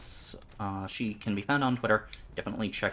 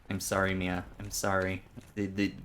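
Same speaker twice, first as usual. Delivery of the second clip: usual speaking voice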